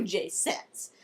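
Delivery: ordinary delivery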